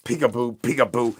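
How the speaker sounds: a sing-song tease, punched loud; loud playful peekaboo. Acted. Streamer teasing the chat, loud playful peekaboo. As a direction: call it out loud and teasing, like popping out of hiding.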